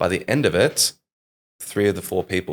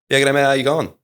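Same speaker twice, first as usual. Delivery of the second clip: higher, louder broad Aussie greeting; strong Australian accent; 6 semitones higher, 5 dB louder than usual. Shown as a demonstration. Introducing himself to people abroad, strong Australian accent. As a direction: rattle it off in thick, run-together Australian. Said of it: "I did have a very strong accent".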